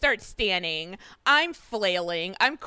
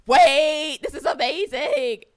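louder, higher mock gasp of delight; excited amazement; 5 semitones higher, 8 dB louder, slower than usual. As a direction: relive being blown away, half laughing. Acts out her delighted reaction.

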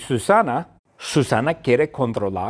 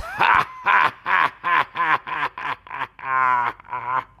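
put-on baby's laugh. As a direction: cackle with delight. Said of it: "Y Lina se ríe una vez más".

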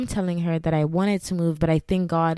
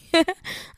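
laugh; 12 semitones higher than usual. Real, not acted. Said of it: "I'm so dramatic".

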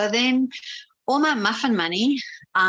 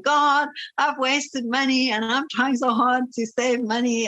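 higher, slower, whiny complaint; little tantrum; 6 semitones higher than usual. Retold or imitated. Complaining to God about wasted money, whiny tantrum. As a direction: sulk at God like a child denied a treat. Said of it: "having my little tantrum".

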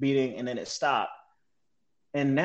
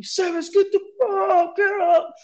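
high, loud retold yell; sergeant yelling; 4 dB louder than usual. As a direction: shout him awake like a sergeant. Sergeant yelling him awake.

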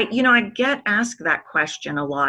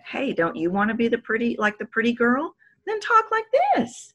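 higher put-on girly lilt, climbing to very high; pretty girl voice; 6 semitones higher than usual. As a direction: mimic the pretty girl's uptalk. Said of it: "the subconscious said".

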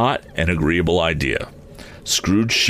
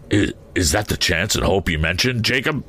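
usual speaking voice

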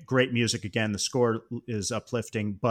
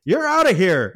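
loud ejection call; 8 semitones higher, 7 dB louder than usual. Retold or imitated. Referee tossing the coach from the game, loud and high. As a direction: bark it out high and loud like a ref.

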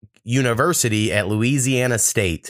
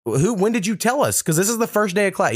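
high-pitched mock student protest; retold protest; 9 semitones higher than usual. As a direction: protest to the professor in a raised, incredulous pitch.

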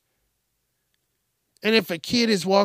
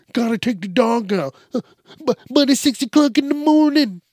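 put-on whiny kid; 8 semitones higher, 5 dB louder than usual. Imitating whiny kid complaining.

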